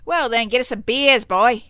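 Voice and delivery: put-on bossing the boy about, full volume; bossy gangster order. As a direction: bark the order at a crony. Mobster orders a crony around.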